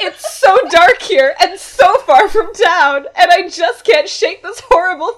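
loud, high-pitched theatrical crying; mock sobbing. Girl pleading with date in dark woods, hammed-up sobbing. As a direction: sob it out in over-the-top melodrama. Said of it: "she sobbed".

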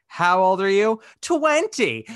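loud, high-pitched mock exchange; acted scene dialogue. Retelling Bill quizzing Sophie about her age, high and loud.

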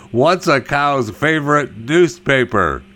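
normal talking voice

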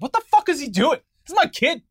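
high-pitched imitation of an indignant parent; outraged parent. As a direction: protest like an upset parent on TV. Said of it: "interviews with parents who were like".

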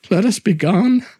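anxious plea. Acted. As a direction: ask urgently to leave. Fortunato pleads to leave.

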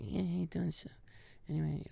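deadpan Sandler under the breath; barely audible Adam. Retold or imitated. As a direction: toss it away almost unheard. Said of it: "you can barely hear the guy".

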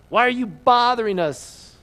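high, loud shout at Jesus. Acted. Possessed man shouting at Jesus in the synagogue, loud. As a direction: shout it out, startled and hostile. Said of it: "He began shouting".

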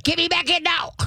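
a freezing pup demanding to go back indoors; impatient dog. Retold or imitated. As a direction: voice the cold dog begging to be let back in. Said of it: "They're like".

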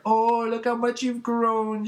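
high, drawn-out gushing coo; put-on falsetto fussing over a child; doting woman. Elastigirl gives Beast Boy a noogie, mock-doting.

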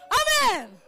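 loud, high preacher's amen; shouted amen. Real, not acted. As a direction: fire out a forceful amen to the congregation. (크고 높게 외치는 아멘)

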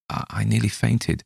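usual speaking voice

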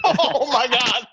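stretched out slowly through a burst of laughter; can barely get the words out for laughing; laughing real. Real, not acted. Cracks up at the log ride line.

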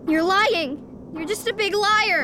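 put-on high, hurt child accusing; upset accusation. Child calling her father a liar, upset accusation.

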